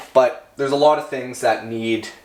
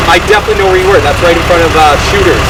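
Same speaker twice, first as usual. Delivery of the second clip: raised voice over noise; 5 semitones higher, 10 dB louder than usual. Real, not acted. Chatting loudly with tow driver.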